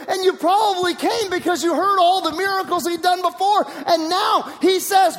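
high, slow, emphatic exhortation; preaching at full force. Real, not acted. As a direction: raise the pitch and press each phrase slowly. Preacher rebukes the crowd.

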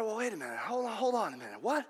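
a quiet, mock-surprised objection; mock startled protest. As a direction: play the caught-off-guard objector quietly. Satan objects to Jesus' prayer.

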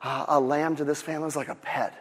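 low, soft, tender telling; emotional storytelling. Acted. Nathan tells David of the pet lamb, emotional. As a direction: play gently on the heartstrings. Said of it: "Nathan really turns on the, the emotional".

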